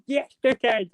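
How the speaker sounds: high Hull catchphrase; imitated Hull accent. Viewer retelling the character popping up, imitated Hull accent. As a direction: copy the character's Hull greeting. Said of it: "He was like".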